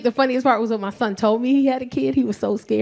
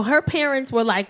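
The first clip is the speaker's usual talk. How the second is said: ordinary delivery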